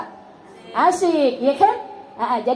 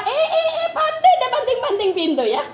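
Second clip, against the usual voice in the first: much higher, sarcastic mother with a laugh; sarcastic scolding; 11 semitones higher than usual. Acted. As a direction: tease the door-slamming child, mockingly. Mother mocks slamming child.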